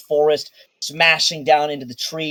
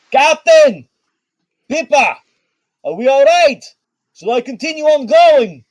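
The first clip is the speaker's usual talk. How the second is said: high, loud shout from above; high shouting sailor calling to his captain; calling down from the helm; 12 semitones higher, 8 dB louder than usual. Acted. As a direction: call down to the deck across the ship. GM voices Baco calling down.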